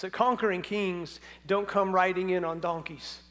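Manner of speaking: normal talking voice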